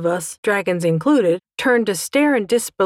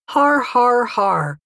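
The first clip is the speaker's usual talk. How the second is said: spoken, sarcastic har-hars; mock the joke with deliberate fake laughter; 5 semitones higher, much slower than usual. A narrator's reading. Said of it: "mocked Pecker".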